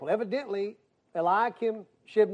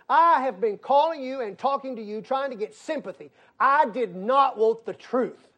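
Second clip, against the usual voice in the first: angry caller; 5 semitones higher, 5 dB louder than usual. Retold or imitated. Acted angry caller's complaint.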